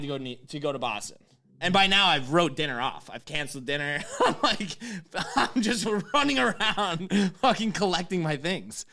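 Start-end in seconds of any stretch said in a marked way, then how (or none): 4.1-8.8 higher, slow, broken up by laughter; amused exasperation through laughs; laughing. Real, not acted. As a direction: laugh helplessly at your own misadventure. Laughs at his own running around.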